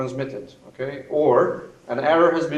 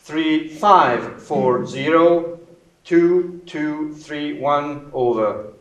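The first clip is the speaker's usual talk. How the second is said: slow, louder radio readout; slow corrected readout; 3 semitones higher, 5 dB louder, much slower than usual. Shown as a demonstration. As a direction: spell out each digit slowly.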